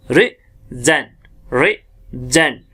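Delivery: Regent slow, weight on the ज, ri-ZENT; teacher drills regent slowly; stress on z. Shown as a demonstration. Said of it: "ज पे हमारा full stress पड़ता है".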